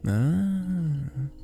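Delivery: long, high "ah" of understanding; dawning realization. Acted. Bartender grasps why the guest avoids crowds, dawning realization.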